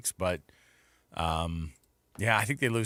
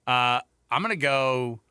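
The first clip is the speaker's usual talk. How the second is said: normal talking voice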